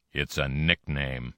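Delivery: low, slow big-man growl; a hint of a heavy's growl. A narrator's reading. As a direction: growl it like a big tough guy. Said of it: "the big man growled".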